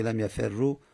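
لم یفرو read "lam yaferru"; damma ending. Shown as a demonstration. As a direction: end لم یفرو on doubled r and u. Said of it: "دمه جایز نیست".

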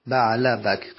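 "بعله بک" said as "baʕla bak", a on the end of بعل; fatha on first part. Shown as a demonstration.

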